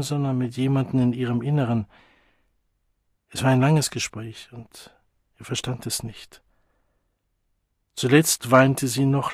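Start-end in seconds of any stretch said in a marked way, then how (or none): none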